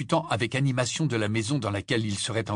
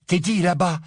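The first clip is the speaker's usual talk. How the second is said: breathless excited; 8 semitones higher, 6 dB louder than usual. A narrator's reading. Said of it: "tout essoufflé".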